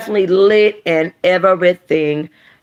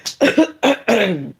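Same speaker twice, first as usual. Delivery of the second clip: real amused laugh; 5 semitones higher than usual. Real, not acted. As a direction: laugh at your own act. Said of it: "laughing at own voices".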